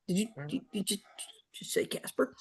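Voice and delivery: breathless stunned whisper; hushed, amazed whisper to the guest; awed whisper. Retold or imitated. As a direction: whisper it in disbelief. Stunned guys asking about Casper.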